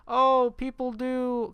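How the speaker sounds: high, loud, drawn-out mock dismay; mock a preachy cartoon character; mocking imitation. Mocking movie character's life lesson.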